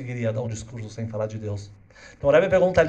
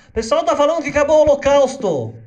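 put-on higher, louder child's voice; little girl voice; 12 semitones higher, 10 dB louder than usual. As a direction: play an eight-year-old girl pleading. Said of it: "Essa menina vai pro Rebbe e fala". (voz de menina, mais aguda e alta)

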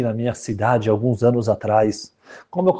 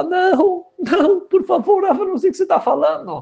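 begging groom. Acted. Exposed groom begging the rabbi, high and pleading. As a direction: beg him not to expose you, voice pitched way up.

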